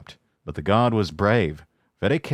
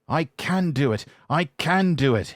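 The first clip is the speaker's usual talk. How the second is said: puffing effort; 7 semitones higher than usual. Acted. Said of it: "he puffed".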